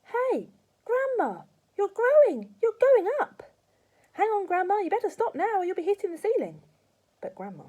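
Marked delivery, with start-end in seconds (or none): 0.0-3.4 put-on high startled shout of a boy; high, loud startled cry. A narrator's reading. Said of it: "George gave a jump and shouted". 4.1-6.6 put-on high, loud worried boy; anxious boy shouting up high; alarmed boy. A narrator's reading. Boy alarmed at growing grandma.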